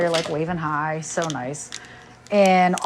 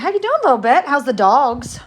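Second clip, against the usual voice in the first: high, louder sweet greeting; affectionate greeting; 7 semitones higher, 7 dB louder than usual. Real, not acted. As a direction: greet a favourite viewer fondly. Greeting a viewer in chat.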